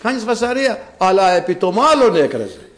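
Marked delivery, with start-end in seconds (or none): 0.0-0.9 high-pitched mock hushing; imitated scolding. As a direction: lightly act a fussy telling-off, raised in pitch. Crowd hushing the crying Bartimaeus.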